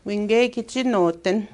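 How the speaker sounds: slow, careful model pronunciation; slow demo. Shown as a demonstration. Teacher modelling the phrase slowly for students. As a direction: lay each word out slowly. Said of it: "It is very windy today".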